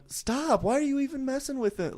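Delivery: wife telling her husband to stop, mock high protest. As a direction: play the annoyed wife in a high voice. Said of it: "she's going".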